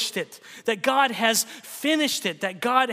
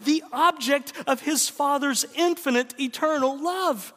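impassioned preaching; 5 semitones higher than usual. Acted. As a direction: preach it with rising fervor toward the climax. Preaching at full force.

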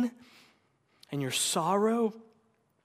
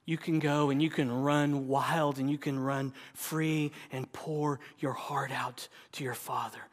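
normal talking voice

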